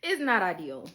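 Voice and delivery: repeats it through a real laugh; chuckles the words out after a burst of laughter. Real, not acted. A mom snowed in with her toddler, laughing.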